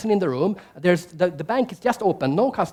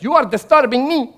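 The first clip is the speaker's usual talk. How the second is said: louder, higher offended snap; indignant retort; 6 semitones higher, 7 dB louder than usual. Retold or imitated. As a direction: throw it back at him. German retorts to brother.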